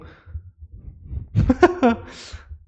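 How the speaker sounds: long rire aigu, sans voix; rire soufflé. Real, not acted. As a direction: pouffe longuement. Rit de sa propre phrase. (rire essoufflé et sonore)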